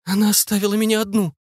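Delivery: high, bereft voice; high-pitched distressed mother; stricken lament. A narrator's reading. Mother whose daughter has jumped.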